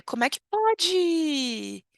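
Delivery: high, drawn-out how-can-it-be; dismayed disbelief. Shown as a demonstration. Teacher demonstrates indignant Portuguese phrase. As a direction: stretch the phrase out in slow dismay.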